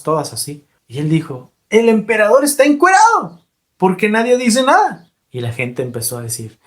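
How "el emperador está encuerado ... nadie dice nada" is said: put-on higher, louder child's cry of disbelief; a boy shouting what everyone sees; child exclaims loudly to the crowd; child blurting out. Child calls out the truth.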